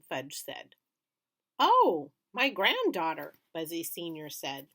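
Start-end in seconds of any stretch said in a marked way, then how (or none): 1.6-3.4 pleased surprise. A narrator's reading. Grandfather delighted.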